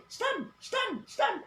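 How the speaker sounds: high, repeated "Stand" in a teasing sing-song; mock sing-song command. Shown as a demonstration. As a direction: mimic the wrong way to give the order. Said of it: "In English, we do not say".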